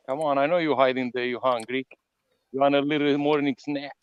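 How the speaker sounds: ordinary talk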